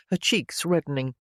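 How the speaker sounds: ordinary delivery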